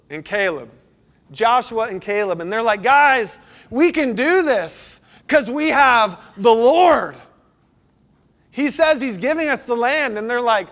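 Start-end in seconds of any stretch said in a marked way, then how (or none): 2.8-10.2 higher, louder, slow and eager; eager rally. Acted. Joshua and Caleb urging the spies, eager and loud. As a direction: fire up your doubting friends.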